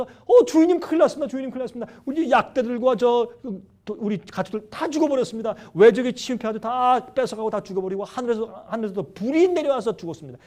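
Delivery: high, loud cry of a servant bringing disaster; alarmed servant. Retold or imitated. Servant runs to master Job with bad news, high alarmed report. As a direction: imitate a messenger rushing in with terrible news. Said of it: "어떤 종이 달려와서".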